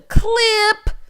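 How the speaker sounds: high, loud, drawn-out catchphrase; Dorinda impression. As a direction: belt out the one word, stretched high. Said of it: "Y'all know Dorinda".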